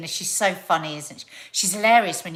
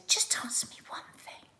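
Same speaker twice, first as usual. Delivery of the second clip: conspiratorial whisper of her friend's rant; whispered quote; 3 semitones higher, 6 dB quieter than usual. Retold or imitated. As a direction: hush it like a juicy secret. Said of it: "and she said".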